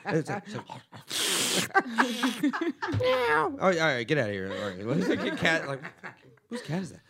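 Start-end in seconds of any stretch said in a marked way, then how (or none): 3.0-3.6 high, loud imitated meow; mock cat meow, much higher. Cat heard over the phone.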